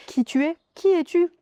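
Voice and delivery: quoted curious questions, pitched high; high-pitched, insistent mock questioning; keen questioning. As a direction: imitate people eagerly asking a newcomer. Voices neighbours' curious questions to newcomer.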